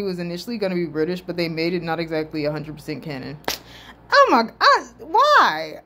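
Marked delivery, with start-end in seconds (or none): 4.1-5.8 high, loud exasperated plea; exasperated outburst. Real, not acted. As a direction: cry out in exasperated disbelief. Exasperated at the canon comment.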